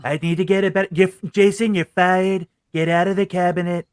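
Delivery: a Trump voice, boastful and blustering; firing an aide like Trump, slightly nasal-free and grandiose; Trump impression. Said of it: "and I do the Trump".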